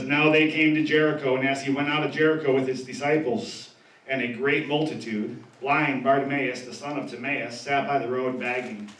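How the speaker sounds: measured reading of the passage; calm Bible reading voice; scripture reading. Acted. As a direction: read the verses out steadily. Reads scripture aloud.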